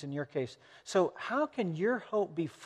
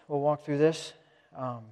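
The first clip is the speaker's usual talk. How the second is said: ordinary talk, nothing marked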